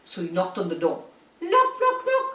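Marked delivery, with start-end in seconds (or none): 1.4-2.3 high, sing-song knock knock; knocking sound. Acted. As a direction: knock with a bright, high call. Wolf knocks on door.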